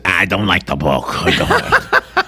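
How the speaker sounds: low, grumbling put-on celebrity voice; mock grumble, played for laughs; mock disgruntled celebrity. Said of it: "and the celebrity says".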